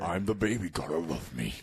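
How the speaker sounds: loud mock baby voice; toddler character impression. Co-host jokes about an undead toddler, mock baby voice. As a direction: burst in as a bratty toddler, loud then dropping.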